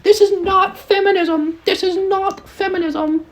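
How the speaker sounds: high squeaky mocking voice of outraged fans; mock high-pitched fan outrage; mocking girls. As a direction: whine it like an offended fangirl. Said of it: "They're like".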